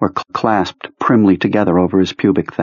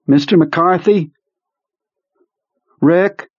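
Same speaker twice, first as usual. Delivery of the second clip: raised, high calling voice; 10 semitones higher, slower than usual. Acted. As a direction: call his name from across the room. Calling to unresponsive man.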